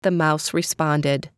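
usual speaking voice

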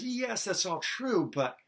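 high-pitched, drawn-out mock agreement; acted Matthew's concession. Matthew answers the official genealogy, high drawn-out concession. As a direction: concede it slowly and high, with an objection coming. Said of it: "So Matthew says".

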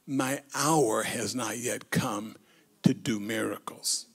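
soft, low quoted words, fading to a whisper; hushed, nearly whispered scripture quote. Retold or imitated. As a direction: let Jesus' words drop almost to a whisper. Jesus quoted softly in sermon.

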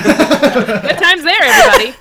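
high, laughing sign-off. Real, not acted. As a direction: laugh through it. Laughs at camera joke.

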